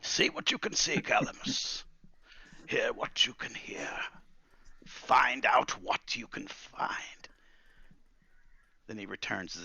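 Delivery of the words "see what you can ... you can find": slow, breathy incantatory orders; each command weighed by an old master; old wizard, solemnly instructing. Acted. Wizard sending Calamus to scout, solemnly instructing.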